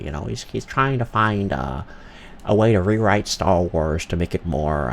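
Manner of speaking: a hint of mumbling awkward George Lucas; George Lucas impression. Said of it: "kinda sounds like this".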